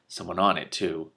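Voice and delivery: usual speaking voice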